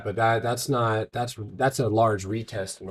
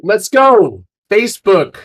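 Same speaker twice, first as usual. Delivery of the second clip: loud hyped cheer; excited cheer; 8 semitones higher, 14 dB louder, slower than usual. Real, not acted. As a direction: shout it out in excitement. Cheering a winning trade.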